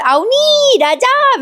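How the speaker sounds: very high calling voice, names drawn out and falling; a made-up high voice calling out on arrival; odd shrill call. Retold or imitated. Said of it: "वेगळाच आवाज काढते बरं का ती".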